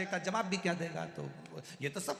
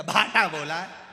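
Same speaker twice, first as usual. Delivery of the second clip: angry reply; 11 dB louder, slower than usual. Retold or imitated. मरीज गुस्से में जवाब.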